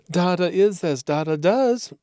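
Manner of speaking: put-on high, gleeful, camp young dandy; gleefully effeminate young man. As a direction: toss it off with playful glee. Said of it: "the voice of a gleefully effeminate young man".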